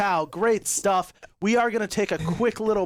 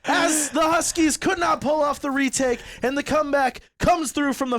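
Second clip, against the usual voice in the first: high, loud hyped victory call; excited shout; 7 semitones higher, 3 dB louder than usual. Real, not acted. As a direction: shout the map win. Map-winning round, live call.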